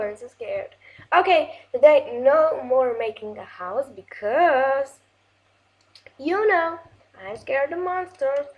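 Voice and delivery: usual speaking voice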